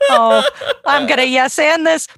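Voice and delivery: imitating co-host through laughter; half-laughing teasing impression of the co-host; laughing mock imitation. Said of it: "You're like".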